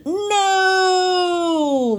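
high anguished wailing cry. A narrator's reading. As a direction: wail it out in despair. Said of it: "Mister Watson wailed".

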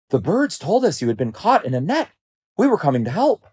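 high, earnest voice; concerned lions. A narrator's reading. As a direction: explain eagerly to a rescued friend. Other lions explain their concern.

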